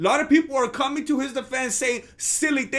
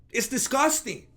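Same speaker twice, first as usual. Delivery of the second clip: ordinary talk, nothing marked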